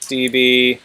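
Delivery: usual speaking voice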